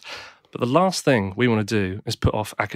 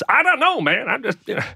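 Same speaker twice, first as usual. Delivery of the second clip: mumbling prisoner; 11 semitones higher than usual. Retold or imitated. The interviewed murderer answers Trevor McDonald, in a squeaky put-on voice. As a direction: play a sheepish killer who shrugs it off.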